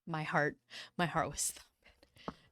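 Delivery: whispered, holding her breath; tense whisper. Real, not acted. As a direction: whisper it like the tension is unbearable. Tense moment while telling the story.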